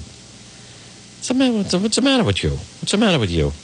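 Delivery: high, fast wiseguy line; mock De Niro scolding. Scolding himself for losing the name.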